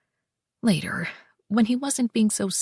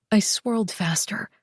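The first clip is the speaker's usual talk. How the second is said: ordinary delivery, nothing marked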